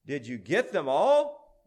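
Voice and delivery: loud demand, climbing at the end; roared. A narrator's reading. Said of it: "roared the prince".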